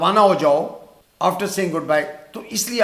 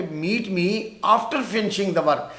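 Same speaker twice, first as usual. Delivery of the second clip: slow example; much slower than usual. Shown as a demonstration. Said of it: "اب دیکھیں".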